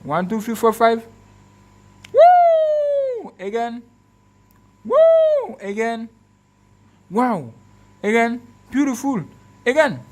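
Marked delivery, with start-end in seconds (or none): 2.1-3.3 a high, loud cheer of delight; excited whoop. Real, not acted. Showing the phone camera to viewers, a delighted high whoop. 4.8-5.6 a high, loud cheer of delight; excited whoop. Real, not acted. Showing the phone camera to viewers, a delighted high whoop.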